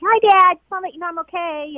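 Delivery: high, chirpy hello then quick reassurance; imagined light check-in, high and hurried; breezy phone greeting. Retold or imitated. Imagined breezy call to her dad.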